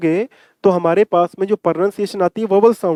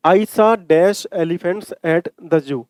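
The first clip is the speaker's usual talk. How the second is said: reading the sentence very slowly, word by word; teacher dictating the question slowly; slow read-aloud; much slower than usual. Shown as a demonstration. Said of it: "दोबारा question पे आओ".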